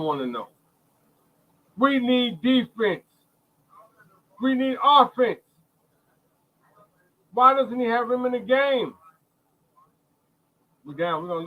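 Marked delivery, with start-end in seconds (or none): 1.8-8.9 exasperated plea. Real, not acted. Pleading with the coach.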